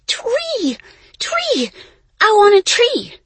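very high, loud excited yell; yelling child. A narrator's reading. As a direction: shout it like an eager little kid. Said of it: "while Lainey yelled".

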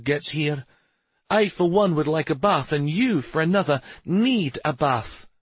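high-pitched exasperated scolding; irritated woman pressing her complaint home; snapping back. A narrator's reading. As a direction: tell someone off, pitch held high. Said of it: "Shari snapped back".

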